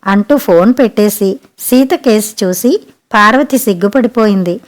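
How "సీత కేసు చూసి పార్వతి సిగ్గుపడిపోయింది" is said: ordinary delivery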